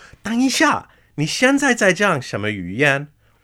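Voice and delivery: hammed-up wait-what; incredulous. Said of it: "And I'd go".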